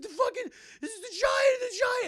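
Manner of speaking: very high, loud acted screaming; frantic shout. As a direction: imitate a hysterical man raising the alarm. Man screaming about giant.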